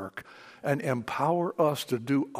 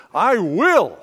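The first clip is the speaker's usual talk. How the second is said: will punched loud and high; stress on will; 4 semitones higher, 9 dB louder than usual. Shown as a demonstration. Pastor stressing God's promise, punch will. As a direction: hit the word will hard. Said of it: "you can emphasize every word".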